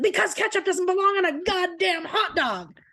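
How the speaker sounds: hammed-up grumpy father laying down the law; dad's mock rant. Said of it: "He's letting his feelings known".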